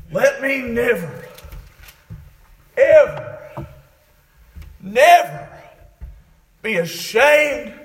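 forceful cry to God, word by word; building, emphatic plea. Retold or imitated. Preacher as David crying out to God, building emphatic plea.

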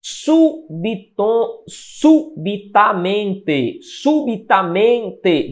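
"subitamente" high and very slow, syllable by syllable, SU and MEN emphasized; stress on SU and MEN. Shown as a demonstration. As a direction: hit both SU and MEN in "subitamente", stretching each syllable. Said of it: "também é pronunciada com ênfase". ("subitamente" agudo e pausado, com ênfase em SU e MEN)

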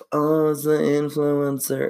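slow, starstruck fan voice; lightly voiced awed fan; mock fan gasp. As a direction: gush like a fan spotting a star. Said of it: "so it's not like".

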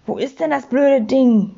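plain reading voice

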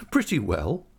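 a touch of a laugh in a light lifting reply; laughing lightly. A narrator's reading. Agnes teasing David gently: a hint of laughter. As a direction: answer through a small amused chuckle. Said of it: "returned Agnes, laughing".

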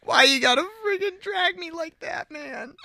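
very high, drawn-out whine; wailing protest. Real, not acted. Host to friend who dragged them, high wailing complaint. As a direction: wail it slowly at the top of your range.

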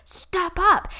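high voiced coach call; high pep-talk repeat; Tony Robbins. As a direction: lift it up high, pushing them forward. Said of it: "He's like".

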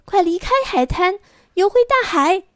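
high, loud urgent shout. Acted. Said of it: "小海螺大声喊".

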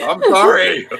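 laughing through "I'm sorry", high and loud; laughing apology. Real, not acted. Player apologising to the table for the accent, laughing. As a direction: apologise while cracking up.

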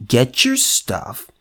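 ordinary talk

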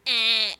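loud imitated bird yell; bird squawk. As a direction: let out one aggressive squawk. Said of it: "like, aggressively yelling at us".

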